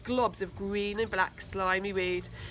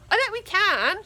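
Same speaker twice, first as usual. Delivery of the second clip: much higher, louder defiant shout; 11 semitones higher, 6 dB louder than usual. A narrator's reading. Tina shouting back at the bully, high and loud. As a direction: fling it back at him. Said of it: "shouted Tina".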